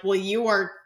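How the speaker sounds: ordinary talk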